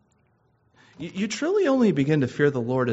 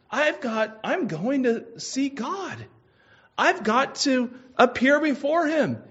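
dying thief's realization; 9 semitones higher, 3 dB louder than usual. Acted. Humble thief realizes he must face God, earnest and high. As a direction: let it dawn on him, urgent.

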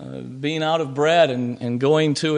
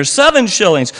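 auctioneer's call; 7 semitones higher, 7 dB louder than usual. A narrator's reading. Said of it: "said the auctioneer".

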